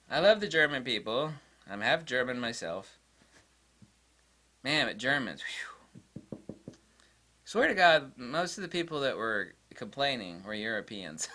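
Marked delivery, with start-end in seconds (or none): none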